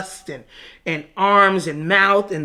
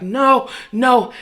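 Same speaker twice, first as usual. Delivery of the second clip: raised, louder mock protest; acted protest; 5 semitones higher, 5 dB louder than usual. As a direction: protest higher and louder, retelling it. Acted resistance in retelling.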